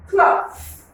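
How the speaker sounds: cloth said high and clear for the class; model word. Shown as a demonstration. Teacher modelling a word for pupils, high and clear. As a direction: pitch "cloth" up and hold it clearly. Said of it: "Can you pronounce that?".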